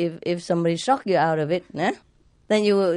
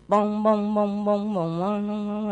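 steady bong bong, fading away; imitated bong sounds; slower than usual. Imitating the monks' daily beating, fading bongs. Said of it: "They beat the wooden fish every day".

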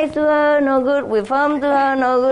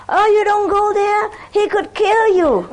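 high, sing-song fake scolding of a dog; mock-scolding neighbor; 9 semitones higher than usual. Neighbor faking a warning to her dog, high and sing-song.

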